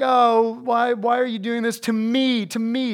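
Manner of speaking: high, hammed-up self-pitying whine; mock complaining. Preacher mimics King Saul's why-me complaint, mock whining. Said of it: "he's complaining".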